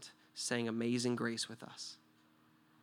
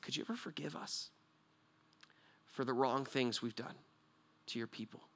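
humble soft plea; 5 dB quieter than usual. Retold or imitated. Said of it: "So I came to him and I went low".

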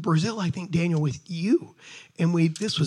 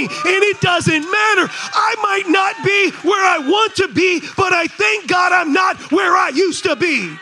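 loud, high shouted preaching; full-force preaching; 12 semitones higher, 8 dB louder than usual. Real, not acted. Preacher exhorting the congregation, shouting high.

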